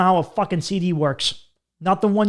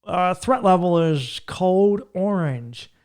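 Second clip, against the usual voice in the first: slow, higher official announcer voice; government announcer; 3 semitones higher, slower than usual. Retold or imitated. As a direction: read it like a TV terror alert. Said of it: "they would just be like".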